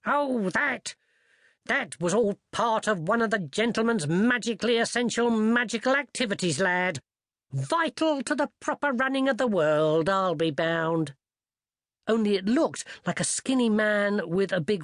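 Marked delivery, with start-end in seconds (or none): 0.0-11.1 put-on higher, knowing old servant. Old servant explaining wizard business to the lad, higher put-on voice. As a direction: explain with humble self-assurance.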